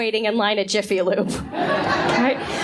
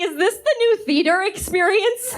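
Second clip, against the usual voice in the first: high, wide-eyed question; amazed first-timer at the movies; mock wonder; 7 semitones higher than usual. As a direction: play it like a baffled moviegoer. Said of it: "And I was like".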